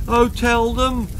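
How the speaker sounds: put-on boy begging the cook for help; pleading. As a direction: beg the cook. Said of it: "pleaded Herbert".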